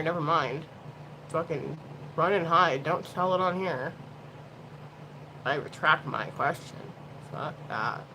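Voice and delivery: normal talking voice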